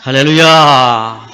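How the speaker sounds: long, loud, slow hallelujah call; drawn-out hallelujah. Real, not acted. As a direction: stretch it out to rally the church. (길게 끌며 크게 외치는 할렐루야)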